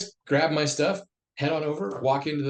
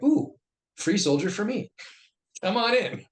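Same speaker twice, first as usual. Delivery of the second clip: gleeful welcome; 9 semitones higher, 4 dB louder than usual. Retold or imitated. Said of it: "knew how to play politics".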